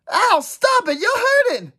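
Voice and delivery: high, loud yelps; squealing in pain. Acted. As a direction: cry out for them to stop. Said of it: "squealed in mock terror and then in real pain".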